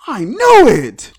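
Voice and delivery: loud, slow gloat; triumphant. Real, not acted. Gloating to viewers after being proven right, loud and slow. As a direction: crow it like you called it.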